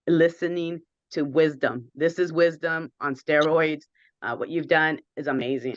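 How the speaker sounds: ordinary talk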